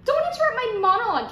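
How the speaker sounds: fast, high, loud rebuke; angry rapid-fire scolding; indignant snap. Acted. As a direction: cut the interrupter off. Snaps at interrupting boyfriend.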